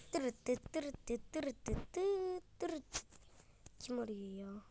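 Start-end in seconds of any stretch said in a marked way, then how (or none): 0.1-3.0 imitated phone ring.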